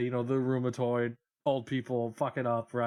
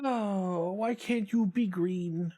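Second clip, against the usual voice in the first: high pleading singsong; 4 semitones higher, slower than usual. Acted. Pleading with game again.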